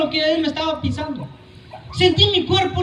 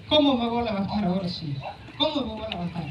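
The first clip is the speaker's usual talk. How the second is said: despairing; 8 semitones lower, 8 dB quieter, faster than usual. Retold or imitated.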